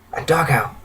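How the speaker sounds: soft, weak, subdued voice; hushed, pained refugee voice; crying dryly. A narrator's reading. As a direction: say it frailly, as if worn out from dry tears. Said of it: "starts crying dryly".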